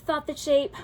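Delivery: ordinary delivery, nothing marked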